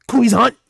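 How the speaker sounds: short, high, loud gut grunt; grunted shout. Shown as a demonstration. Imitating a fighting game grunt, loud and high. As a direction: bark it out from the belly. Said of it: "when they be doing that".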